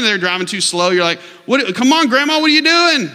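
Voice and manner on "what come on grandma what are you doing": high, loud mock driver's yell; exasperated yelling. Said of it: "We yell at people".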